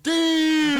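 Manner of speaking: loud, high mock yell; retold shocked shout. Storyteller imitating everybody seeing the wife, high shout. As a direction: yell it out like a stunned crowd.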